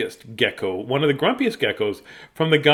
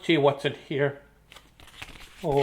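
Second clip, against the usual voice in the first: ordinary delivery, nothing marked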